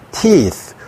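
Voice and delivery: 'teeth' slowly, long ee held; long vowel demo. Shown as a demonstration. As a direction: stretch the ee in 'teeth'. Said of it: "장모음". ('teeth' 장모음을 길게)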